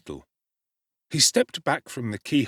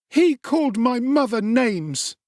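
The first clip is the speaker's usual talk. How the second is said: high, slow wounded protest; a child standing up for his mother; hurt boy; 14 semitones higher, 4 dB louder, slower than usual. A narrator's reading. Oliver explaining to Sowerberry, hurt and high.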